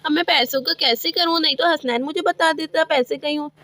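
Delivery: ordinary talk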